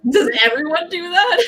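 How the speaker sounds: laughing high as she asks; real amused laugh. Real, not acted. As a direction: giggle through the question. Laughing at own habit.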